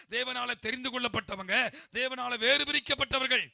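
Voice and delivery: loud, rousing list from the pulpit; full-force preaching. Acted. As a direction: hammer out each phrase to the congregation.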